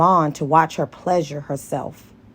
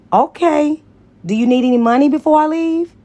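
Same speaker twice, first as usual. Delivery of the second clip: eager offer; 9 semitones higher, 5 dB louder than usual. Acted.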